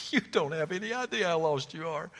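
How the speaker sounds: chuckling, changed voice; amused local. Retold or imitated. Gas station attendant laughing at a lost driver. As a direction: tease the lost stranger.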